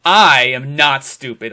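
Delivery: ordinary talk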